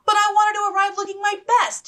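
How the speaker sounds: spoiled sister whining. Acted. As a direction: whine like a spoiled girl. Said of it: "she whined".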